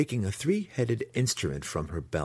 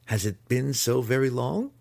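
mildly nervous, higher-pitched question; a touch of nerves, pitched up; 6 semitones higher, 4 dB louder than usual. A narrator's reading. Impostor covering his surprise to the matron, nervously high.

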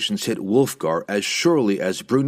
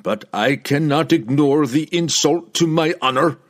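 higher, forceful indignant declaration; indignant barbarian's protest; proud protest; 6 semitones higher, 4 dB louder than usual. A narrator's reading. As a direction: stand on wounded honor, voice raised. Proud barbarian protests his honor.

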